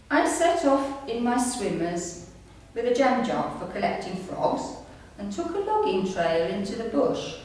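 ordinary delivery

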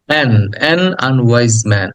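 drawn-out teaching demo of অ্যান; slow careful article. Shown as a demonstration. Teacher modelling অ্যান unwise man for students, slow and careful. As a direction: stretch অ্যান clearly before unwise man. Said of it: "খেয়াল করো, আন".

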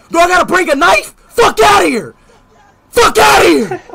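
high, loud furious yell; angry shouting. Real, not acted. Streamer threatens the British player over the call, angry shout. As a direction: bark it at him, enraged. Heated argument with opponent.

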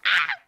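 short burst of a scream; excited scream. Real, not acted. Streamer invited to join Lucky, excited scream. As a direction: let out a quick scream.

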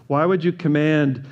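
usual speaking voice

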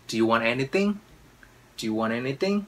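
"Do you want anything" level, then climbing on "anything"; questioning intonation. Shown as a demonstration. Teacher models asking someone, "anything" rising at the end. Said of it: "có cái ngữ điệu đó".

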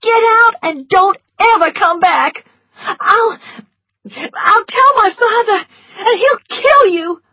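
high, loud outraged tirade; shrieking with outrage, stumbling into threats; furious cry. A narrator's reading. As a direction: scream threats at him in fury. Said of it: "she cried".

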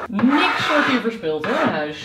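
slow words through laughter; laughing. Real, not acted. Laughing at own mess.

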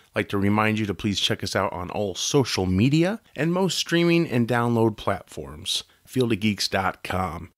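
ad read. Acted.

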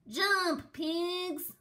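high, loud shout; urgent cry. A narrator's reading. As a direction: yell it to make them jump. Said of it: "cried Fluffy the Explorer".

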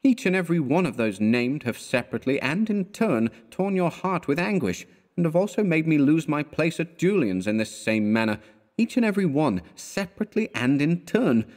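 friend's mock-solemn verdict. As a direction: declare it like an oath. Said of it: "said Elliot solemnly".

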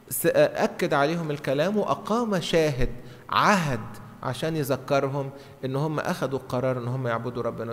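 usual speaking voice

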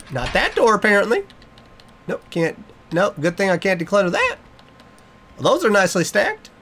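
usual speaking voice

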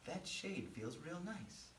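soft, slow, relaxed sigh; contented relief. Acted. Jonah sitting in the shade on a hot day, contented sigh. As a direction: ease into it with quiet pleasure. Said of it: "began to feel kinda good".

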